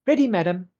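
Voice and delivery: high, loud, eager helper; high-voiced shop girl; small girl assistant. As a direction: pipe up to report for duty. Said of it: "And she goes".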